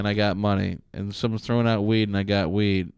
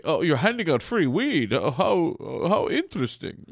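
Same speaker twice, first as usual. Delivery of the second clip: high airy put-on voice; fancy gentleman voice; 6 semitones higher than usual. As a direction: play a prim gentleman feigning surprise. Another disguise, fancy customer.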